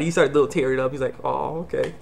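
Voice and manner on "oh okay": soft, mock-tearful acceptance; a touch of tears, hushed; teary-eyed. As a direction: quietly act a little choked up. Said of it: "he's got teary eyed".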